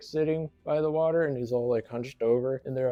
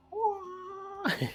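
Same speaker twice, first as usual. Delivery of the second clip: very high, drawn-out mock kung fu cry; imitated martial arts noise; 4 dB quieter than usual. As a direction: let out a falsetto fighting wail. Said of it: "like, a martial arts noise".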